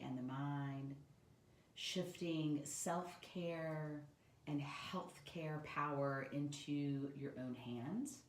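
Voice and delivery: soft, unhurried yoga nidra voice with long pauses; guided meditation. Acted.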